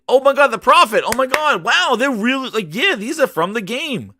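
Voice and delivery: high, gushing fan reaction; excited. Retold or imitated. Fan spotting characters from the game, excited gushing. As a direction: gush in delighted recognition. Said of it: "Getting all excited".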